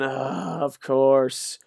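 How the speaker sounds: ordinary delivery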